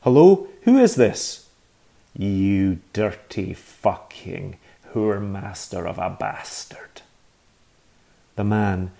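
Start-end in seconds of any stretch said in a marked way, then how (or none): 0.0-1.5 much higher, louder, startled demand; startled shout. Acted. Man startled by the insult. 2.2-7.0 slow, fading into a whisper; slow venomous curse. Acted. Agnes cursing down the phone.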